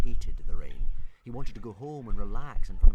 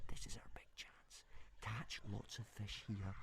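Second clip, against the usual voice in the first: hushed secret whisper into an ear; 28 dB quieter than usual. A narrator's reading. Hiccup whispering a plan into his dragon's ear, hushed. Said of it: "he whispered into the little dragon's ear".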